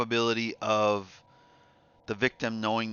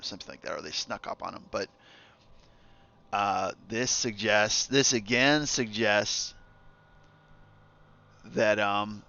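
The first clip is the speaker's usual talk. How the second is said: ordinary talk, nothing marked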